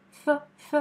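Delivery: a breathy F, lips on teeth, held; F sound demo. Shown as a demonstration. Teacher shows mouth shape to student for F. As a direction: show final в as F. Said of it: "il faut la prononcer comme F".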